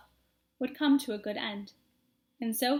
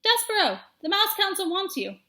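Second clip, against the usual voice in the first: higher, louder summons; urgent call; 8 semitones higher, 5 dB louder than usual. Acted. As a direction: call him away from the book. Furlow calling brother.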